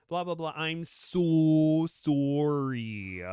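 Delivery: loud, high, drawn-out Canadian sorry; mock Canadian accent. Imitating a Canadian on reality TV, hammed-up Canadian accent. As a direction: stretch the apology in exaggerated Canadian. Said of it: "she had an American accent, but then she's like".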